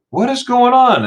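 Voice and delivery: high, loud confused cry; mock alarmed question; bewildered. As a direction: act out waking up baffled. Acted bewilderment on waking up.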